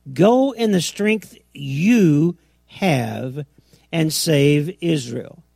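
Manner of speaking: slow, with GO and YOU drawn out; weighty, stretched-out command stressing "you"; deliberate word emphasis. Shown as a demonstration. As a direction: lean long on "you", pausing before "have". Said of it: "See how the emphasis on the words?".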